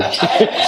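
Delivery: short airy laugh; a quick breathy chuckle. Real, not acted. Laughing after the joke to the audience, short airy chuckle.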